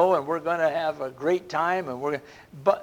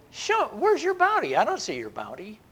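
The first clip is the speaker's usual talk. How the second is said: sneering challenge from a doubter, first line pitched much higher; mocking skeptic; 6 semitones higher than usual. A doubter challenging the preacher, mocking. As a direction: scoff at the idea of bounty. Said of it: "somebody might say".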